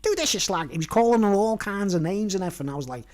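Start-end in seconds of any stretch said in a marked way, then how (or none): 0.0-0.7 mimicked leprechaun orders, starting high; bossy leprechaun. Leprechaun making a woman do things, mimicked bossy voice. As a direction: imitate a little tyrant giving commands. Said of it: "making the woman do things for him".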